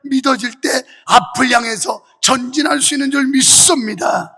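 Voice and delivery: loud, forceful sermon delivery; preaching. Real, not acted. Pastor urging congregation to endure through faith, forceful preaching. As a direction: preach it with driving conviction.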